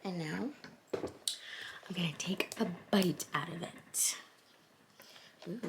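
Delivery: slow soft whisper. Real, not acted. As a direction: whisper it close to the mic. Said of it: "whispering while filming food".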